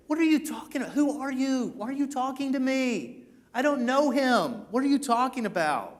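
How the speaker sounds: high-pitched, incredulous protest; indignant protest. Acted. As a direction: deny it as if wrongly accused. Imagined Peter protesting when accused.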